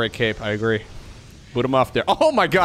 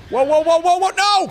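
high, loud panicked whoas; loud alarmed yelling; 6 dB louder than usual. Real, not acted. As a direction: yell it out in shock. Sudden shock in the game.

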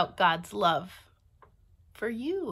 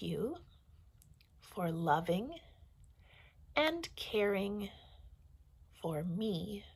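slow, soft prayer said phrase by phrase; gentle children's prayer; 2 semitones lower, 5 dB quieter, slower than usual. Acted. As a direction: lead little ones in prayer, pausing to echo.

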